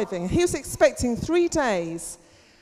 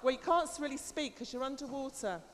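ordinary delivery

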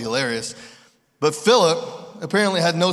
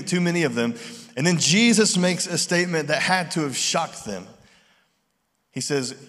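ordinary delivery

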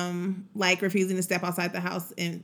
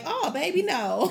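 laughing; 8 semitones higher, slower than usual. Retold or imitated. Turning down swinger invite.

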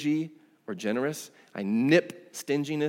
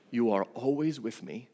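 gentle father; 5 dB quieter than usual. Acted. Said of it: "The father comes to him and goes".

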